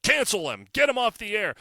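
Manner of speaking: imitating Trump ordering the show cancelled, loud; mock order. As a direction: bark it like a boss giving orders. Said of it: "called up C B S and said".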